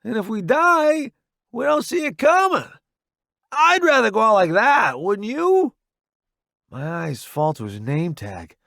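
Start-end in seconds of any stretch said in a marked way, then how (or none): 0.0-5.7 high, raised battlefield voice; shouted reply. A narrator's reading. Rescuer talking to newbie behind the truck, shouted reply. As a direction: call it over the explosions.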